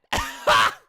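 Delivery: explosive laugh; loud laugh. Real, not acted. Reacting to the threat.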